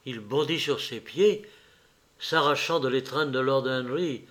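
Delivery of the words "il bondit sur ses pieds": plain reading voice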